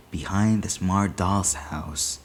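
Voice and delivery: usual speaking voice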